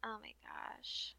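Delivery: breathless whisper. Real, not acted. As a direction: gasp it out under your breath. Reacting to the plot twist.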